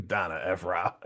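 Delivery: words squeezed out through laughter; real laughing. Real, not acted. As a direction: say it while cracking up. Amused by a chat joke.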